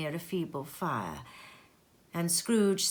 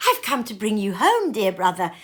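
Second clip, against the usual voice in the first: put-on gleeful child; 3 semitones higher, 8 dB louder, faster than usual. A narrator's reading. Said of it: "clapping her tiny hands".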